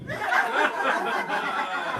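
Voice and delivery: breathy high laugh; a real laugh at the punchline. Real, not acted. Comic laughing at his own story, breathy laugh.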